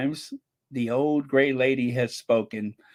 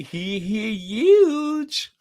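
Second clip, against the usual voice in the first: high, drawn-out giggly glee; gleeful; 6 semitones higher, 3 dB louder, much slower than usual. Real, not acted. Host relishing how big the New York Times story is, gleeful. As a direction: stretch it out high with delight. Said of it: "with a tone of glee in my voice".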